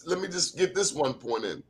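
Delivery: usual speaking voice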